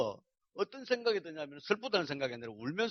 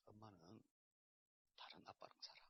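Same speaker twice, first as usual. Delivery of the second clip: breathy whisper, words barely voiced; whispered confession; 7 semitones lower, 23 dB quieter than usual. Father whispering to his son that mom lives with another man. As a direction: let it slip out as a whisper.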